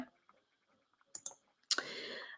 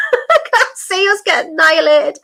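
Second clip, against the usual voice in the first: laughing; 9 semitones higher, 3 dB louder than usual. Real, not acted. Laughing at own joke.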